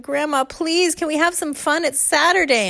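put-on high pleading kids; begging kids. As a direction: beg like a tired child.

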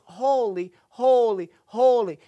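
rising emphatic holy holy holy; building holy. Shown as a demonstration. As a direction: build up the three holies. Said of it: "It is so incredibly important".

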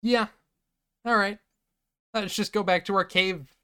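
lightly voiced ghosts; casual ghosts. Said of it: "the ghosts just decide".

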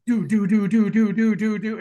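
rapid do do do beats; imitated punch sounds.

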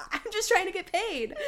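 high-pitched mock teen whine of protest; defensive protest. Daughter excusing herself to mom, high and defensive. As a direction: act out pleading innocence, half laughing.

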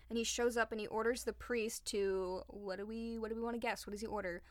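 ordinary talk, nothing marked

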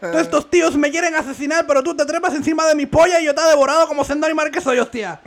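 high, loud Castilian Spanish parody; Spain accent parody. Acted. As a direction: rant in a hammed-up accent from Spain. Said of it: "reggaetoneros con diferentes acentos".

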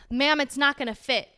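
rude. Retold or imitated. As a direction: imitate a brusque flight attendant. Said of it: "like, really rude to me".

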